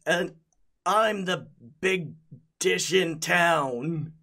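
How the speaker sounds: high, loud tough-guy boast; spaghetti golem voice. Acted. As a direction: swagger like a pasta gangster.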